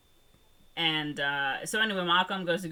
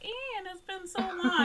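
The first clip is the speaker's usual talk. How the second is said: high, slow, hammed-up weepy; mock tearful reunion; slower than usual. Kids greeting Ian, a high mock-tearful cry. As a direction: overact the sappy reunion. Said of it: "the two kids, they're like".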